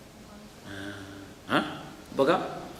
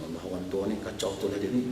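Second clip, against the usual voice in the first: softer, lower muttered thought; inner aside; 8 semitones lower, 7 dB quieter than usual. Acted. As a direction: say it under your breath. Ustaz's exasperated inner thought.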